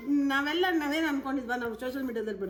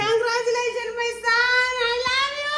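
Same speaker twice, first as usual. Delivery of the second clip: very high, sing-out delight; elated cry of love; overjoyed congratulations; 10 semitones higher, 4 dB louder, much slower than usual. Real, not acted. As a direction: call it out, rising high with emotion. Mother overjoyed at son's news.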